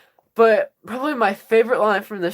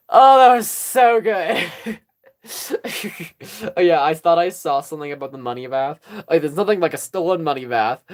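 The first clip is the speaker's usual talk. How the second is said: ordinary delivery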